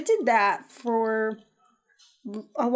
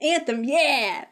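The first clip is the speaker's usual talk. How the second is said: excited cheer; 5 semitones higher, much slower than usual. Shown as a demonstration. Co-host showing what deserves excitement, excited cheer. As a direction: cheer the game's name, stretching it out. Contrasts hype for game with demo.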